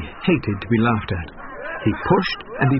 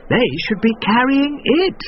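higher, indignant woman; indignant friend; 12 semitones higher than usual. A narrator's reading. Woman's friend complaining about the riders. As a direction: tut at the load. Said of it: "said her friend".

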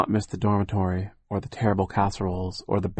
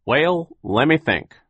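Phil; 7 semitones higher, 6 dB louder, slower than usual. A narrator's reading. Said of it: "Phil said".

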